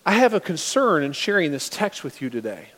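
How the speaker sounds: ordinary talk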